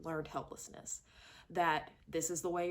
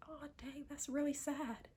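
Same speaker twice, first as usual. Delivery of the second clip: higher, much softer breathy aside; mock sad whisper; 8 semitones higher, 6 dB quieter than usual. Reviewer voicing her own reaction to the mother's line, hushed. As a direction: whisper it like a pitying viewer. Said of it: "And it's like".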